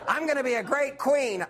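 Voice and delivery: hammed-up regal slip; mock queen. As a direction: gloat then correct yourself.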